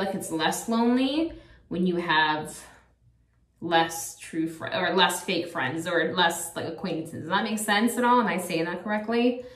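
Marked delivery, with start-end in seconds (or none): none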